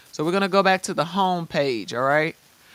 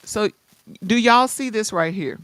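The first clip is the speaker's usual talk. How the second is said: ordinary delivery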